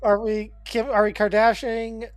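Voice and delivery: ordinary delivery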